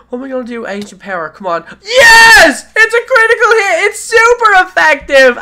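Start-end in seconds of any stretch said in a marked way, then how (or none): 1.8-5.4 high, loud yell of triumph; ecstatic shout. Real, not acted. Gamer celebrating a critical hit, yelling with joy. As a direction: scream it out over the critical hit.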